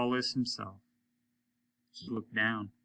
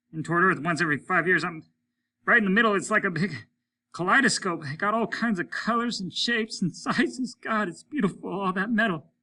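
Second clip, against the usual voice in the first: higher, louder wondering astronaut voice; a man caught in beauty, raised and high; Stone in awe; 7 semitones higher, 5 dB louder than usual. A narrator's reading. Stone marvelling at the meteor swarm, higher and louder.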